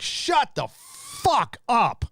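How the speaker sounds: a long, high, fed-up shut the fuck up; drawn-out exasperation. Real, not acted. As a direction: drag it out in total disbelief. Exasperated retort to celebrities.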